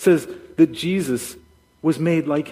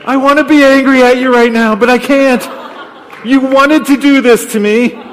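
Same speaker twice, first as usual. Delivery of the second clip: Mary's imagined high, loud protest; frustrated mock yell; 9 semitones higher, 13 dB louder than usual. Mary in labor unable to blame Joseph, mock yelling. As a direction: shout it high, hammed-up thwarted anger. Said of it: "Mary couldn't even yell that at Joseph".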